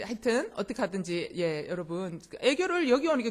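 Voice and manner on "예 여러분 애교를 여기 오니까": usual speaking voice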